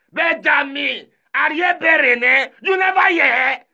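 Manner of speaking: shouted, pitched high, calling across. Real, not acted. Calling out names loudly.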